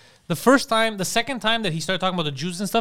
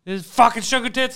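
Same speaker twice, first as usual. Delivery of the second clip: mock drunk rant; 6 semitones higher, 4 dB louder than usual. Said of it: "he's like".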